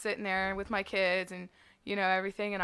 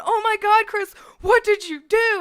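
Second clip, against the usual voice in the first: much higher and louder, a shocked shriek; shocked friend; 12 semitones higher, 11 dB louder than usual. Retold or imitated. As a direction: shriek it like a panicked friend watching. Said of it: "Carly's in the background going".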